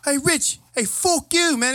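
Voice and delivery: high, loud pretend Irish heckle; mock insult in a put-on Irish accent. Imagined Irish friend cursing out his buddy, playful Irish accent. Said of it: "had an accent like that".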